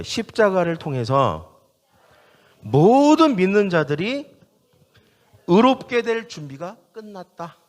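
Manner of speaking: slow, deliberate, phrase-by-phrase proclamation; call for repetition. Shown as a demonstration. Preacher leading the congregation to repeat after him, emphatic call. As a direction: hand it out phrase by phrase to be echoed. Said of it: "저를 따라봐요".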